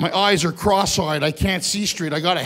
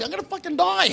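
choking panic; 10 semitones higher than usual. Acted. Choking on stage.

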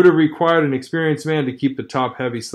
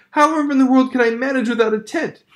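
high, fluttery woman's voice, much higher; a pampered lady aghast at roughing it; dainty dismay; 11 semitones higher than usual. Acted. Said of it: "throwing up her hands in a dainty dismay".

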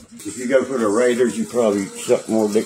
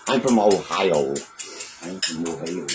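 put-on Ohio accent; 5 semitones lower than usual. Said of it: "Now he's got Bo doing accents".

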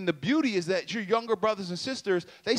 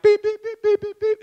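high, rapid voiced keypad beeps; phone dialing beeps; 7 dB louder than usual. Dialing the house phone to call friends, high beeping. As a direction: tap out the number with your voice. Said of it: "my opportunity to create sound effects".

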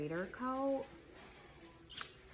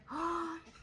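delighted gasp; 5 semitones higher than usual. Real, not acted. Sees a new puppy.

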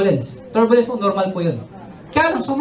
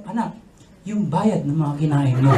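deadpan mother; 8 semitones lower than usual. Retold or imitated. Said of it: "Nung paalis na ako".

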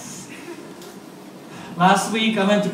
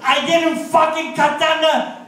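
George Washington impression; 8 semitones higher, slower than usual. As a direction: wail the denial like an offended child-king. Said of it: "I'm gonna do, um, impression of".

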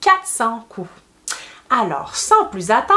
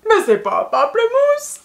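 high-pitched playful protest; mock astonishment; 10 semitones higher than usual. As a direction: object as if baffled, for the children. Reader objects in mock surprise.

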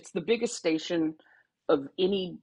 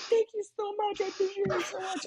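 put-on higher, teary little kid thanking; kid's grateful voice. Crying fan kid thanking her, in a higher kid voice. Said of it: "And he was like".

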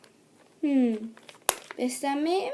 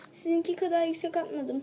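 normal talking voice

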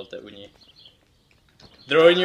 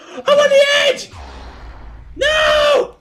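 very high, loud yelling; real game panic; panicked shrieking; 8 dB louder, much slower than usual. Real, not acted. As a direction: scream as you slip from the edge. Falling off the edge.

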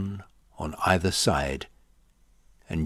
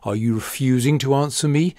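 sharp, fast, higher and louder demand; 6 semitones higher, 6 dB louder, faster than usual. A narrator's reading. Pilate confronting silent Jesus, fast and louder. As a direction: snap the question at the silent prisoner.

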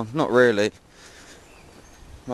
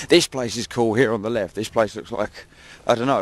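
ordinary delivery

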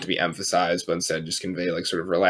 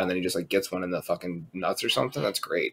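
usual speaking voice

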